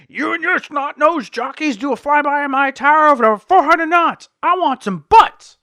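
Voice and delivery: the air boss chewing out Viper, in a hammed-up yell; mock furious yelling. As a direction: bellow at the pilots like a boss. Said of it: "chewing Viper out".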